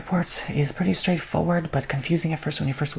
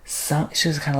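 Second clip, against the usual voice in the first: ordinary talk, nothing marked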